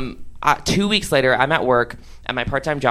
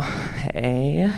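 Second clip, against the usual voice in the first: smooth quiet hey; 8 dB quieter than usual. Retold or imitated. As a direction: play it cool and quiet, like a pickup line. Smooth opener to a girl.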